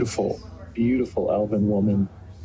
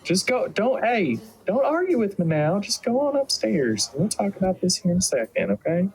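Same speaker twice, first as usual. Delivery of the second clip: put-on high mama voice shooing children along; high-pitched, insistent motherly orders; firm mother; 8 semitones higher than usual. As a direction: send the kids off, brooking no argument. Mother sends the children upstairs.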